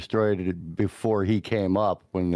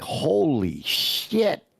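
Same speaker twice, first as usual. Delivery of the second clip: slow amazed; 7 semitones higher, much slower than usual. Retold or imitated. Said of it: "I was like".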